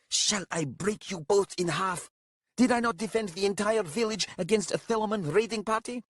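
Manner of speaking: high, strained indignant challenge; threatening. A narrator's reading. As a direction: threaten both of them, outraged. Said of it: "raised them threateningly".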